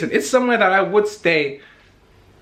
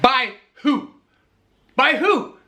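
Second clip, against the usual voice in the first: indignant disbelief; 9 semitones higher, slower than usual. Retold or imitated. Demanding who listed her.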